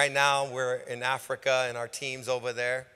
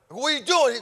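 confronting; 10 semitones higher, 6 dB louder than usual. Retold or imitated. Man confronting pastor.

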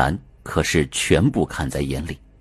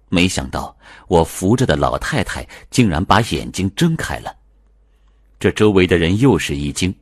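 usual speaking voice

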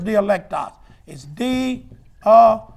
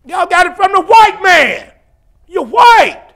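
shouted rebuke, pitch driven way up; indignant preaching shout; 8 semitones higher, 8 dB louder than usual. Real, not acted. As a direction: berate them at the top of your voice. Preacher berates the tongue-speakers.

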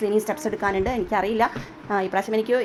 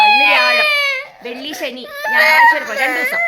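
exasperated counting; 6 dB louder than usual. Real, not acted. Stressed mother counting remaining days.

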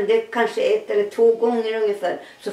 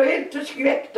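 ordinary talk, nothing marked

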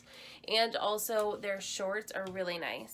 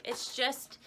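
ordinary talk

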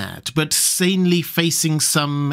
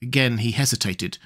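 ordinary delivery

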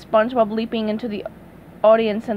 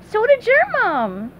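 SpongeBob voice; 11 semitones higher, 3 dB louder than usual. Acted. SpongeBob replies.

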